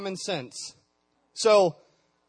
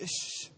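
shushing; 10 dB quieter than usual. Real, not acted. Hushing the room of students.

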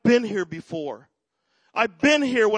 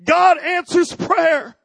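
slow, high, drawn-out declaration; father proclaiming as he runs; joyful proclamation; 7 semitones higher, 5 dB louder, much slower than usual. Retold or imitated. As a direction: stretch each word out in triumph. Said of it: "he was saying".

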